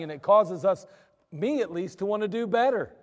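normal talking voice

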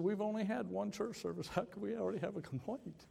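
high incredulous protest with a laugh; baffled disbelief. Retold or imitated.

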